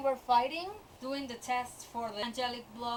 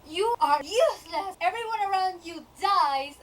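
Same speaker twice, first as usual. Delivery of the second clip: loud, higher cruel yell; 4 semitones higher, 6 dB louder than usual. Retold or imitated. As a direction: bark insults at her. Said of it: "just yells at her".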